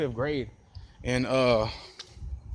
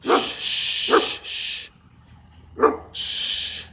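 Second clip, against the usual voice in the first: sharp unvoiced shh hushing; shushing; 4 dB louder, much slower than usual. Real, not acted. Shushing the big dog nearby, hushed hiss. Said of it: "shushing a dog off mic".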